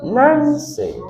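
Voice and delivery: "Nancy" with NAN high and drawn out; town chick. Retold or imitated. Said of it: "to be a town chick".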